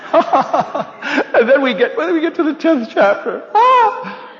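high, slow, laughing build to a cry; laughing excitement. Real, not acted. Telling friends about the tenth chapter, laughing excitedly. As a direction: laugh through it, rising to a squeal.

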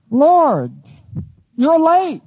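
frustrated believer complains, mock reproachful; complaining to God. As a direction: chide the Lord for being late. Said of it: "You ever felt like saying that?".

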